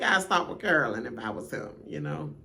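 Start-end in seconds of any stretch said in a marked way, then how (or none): none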